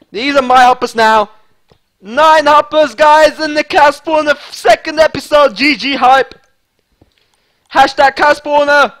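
loud, high-pitched crowing; triumphant hype. Real, not acted. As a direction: hype it up for the viewers. Crowing over the loot.

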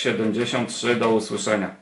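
normal talking voice

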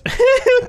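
loud, high startled laugh; high burst of laughter. Real, not acted. Gamer startled by shopkeeper's voice, loud high laugh.

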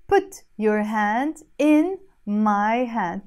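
slow, deliberate English gloss; slow translation. Shown as a demonstration. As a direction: lay each word down slowly. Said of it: "teacher translating slowly".

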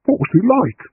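leaning in for the clue; eager question. A narrator's reading. John eagerly asks Agatha about the rambler, keen and high. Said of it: "asked John eagerly".

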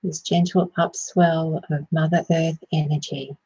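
calm meditation voice with long pauses; unhurried phrases, easing listeners inward; guided meditation. Acted. Meditation host guiding listeners to settle and ground, soothing guided meditation.

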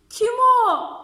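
high, loud shout of who is there. Acted. Fox shouts at the door, loud and high. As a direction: bark out the question from inside. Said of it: "diye bağırmış".

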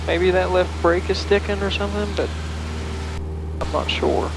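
usual speaking voice

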